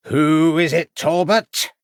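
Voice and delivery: loud booming call down the hall; loud voice calling from the far end. A narrator's reading. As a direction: boom it from far off. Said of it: "boomed a loud voice".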